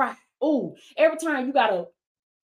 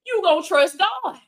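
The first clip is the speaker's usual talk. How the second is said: ordinary talk, nothing marked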